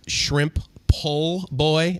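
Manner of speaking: drawn-out questioning repeat; slow puzzled echo of a strange word. Real, not acted. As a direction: sound the name out slowly, unsure. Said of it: "as in pole?".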